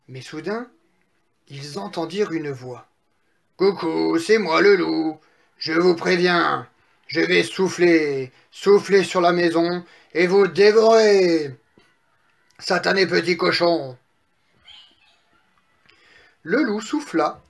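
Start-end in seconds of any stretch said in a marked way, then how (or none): 3.6-14.0 menacing storybook wolf, drawn-out words; big bad wolf. Wolf threatens piglet in straw house, playful menace.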